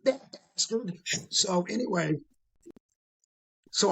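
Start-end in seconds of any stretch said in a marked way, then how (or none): 0.0-1.0 much higher, mocking criminal voice; gleeful criminals. Criminals pointing at the TV, in a much higher mocking voice. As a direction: crow it out like a gloating crook.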